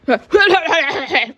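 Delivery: high, put-on laughing; high-pitched mocking laugh; exaggerated fake laugh; mock laughter. Acted noise amid the bickering.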